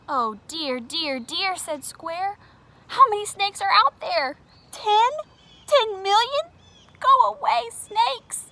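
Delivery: high, loud panicked shrieks; frightened squeal. Acted. Scared Square yelling through the door, high panic. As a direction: shoo the snake away in a panic.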